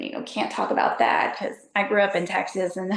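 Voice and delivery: ordinary talk, nothing marked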